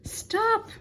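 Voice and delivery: high, loud panicked yell. A narrator's reading. Big Anthony yells at the overflowing pot, high loud yell. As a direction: yell it at the pot with all you have. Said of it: "yelled Big Anthony".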